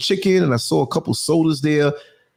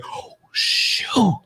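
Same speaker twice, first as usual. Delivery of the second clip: put-on stunned kid; 8 semitones higher than usual. Stunned boy reacting to the confession.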